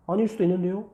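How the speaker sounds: high-pitched pupil voice; high student reply; objecting student. Acted.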